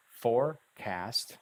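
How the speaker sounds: "forecast" slowly split into "fore" and "cast", each complete; two full words. Shown as a demonstration. Teacher showing that forecast holds two words, each said completely. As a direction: say each half of "forecast" fully. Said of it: "Say each one completely".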